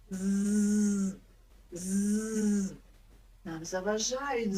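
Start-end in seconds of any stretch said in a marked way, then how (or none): 0.1-2.7 з drawn out as a long buzz. Shown as a demonstration. Teacher buzzes з to show the teeth block it. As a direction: hum the з like a mosquito. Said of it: "Ми можемо його співати дуже голосно і довго".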